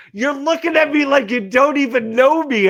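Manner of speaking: high-pitched mock indignation; acting out an aggrieved complaint; retold outrage. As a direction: imitate a hurt accuser, pitched high. Girl's hurt accusation, retold.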